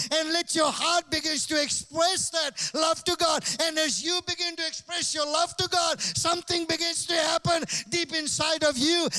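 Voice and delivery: loud, high preaching voice, pushing hard; fiery sermon voice; full-force preaching. Acted. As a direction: preach it out to the crowd.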